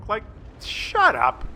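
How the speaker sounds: slow, drawn-out disgusted shut up; mock-disgusted brush-off; exasperated. Real, not acted. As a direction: scoff it out, fed up. Ranting at invisible tank destroyers.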